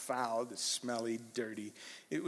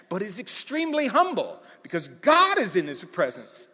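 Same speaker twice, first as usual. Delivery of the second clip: usual speaking voice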